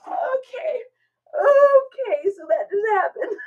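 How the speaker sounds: high, tearful, trying to recover; still laugh-crying. Real, not acted. Recovering from laughing fit in front of chat. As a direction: pull yourself together through the giggles. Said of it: "I'm actually crying".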